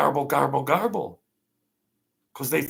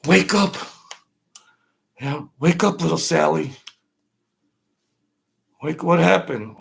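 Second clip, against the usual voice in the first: louder, higher wake-up call; 5 semitones higher, 6 dB louder than usual. Acted. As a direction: shout to rouse a sleeper. Waking someone, skit.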